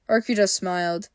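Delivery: usual speaking voice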